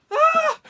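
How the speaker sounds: very high, loud squeal of alarm; mock scream. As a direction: shriek while being yanked along. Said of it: "I'm like".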